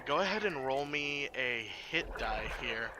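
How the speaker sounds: usual speaking voice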